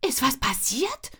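whispered, startled question; frightened. Acted. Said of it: "frage ich erschrocken".

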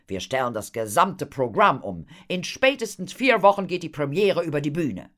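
loud, animated showman's pitch; eager impresario Horatio. Acted. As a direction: sell the big plan with gusto. Horatio pitches his plan. (begeisterter, lauter Impresario)

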